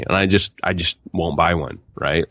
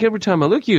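mock wife; 12 semitones higher, 3 dB louder, much faster than usual. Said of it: "she's like".

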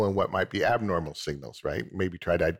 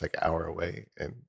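usual speaking voice

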